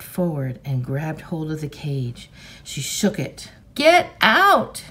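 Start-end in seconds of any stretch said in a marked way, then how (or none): 3.7-4.8 high, loud scream at the tiger. Acted. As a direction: scream it with all her force. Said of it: "she screamed".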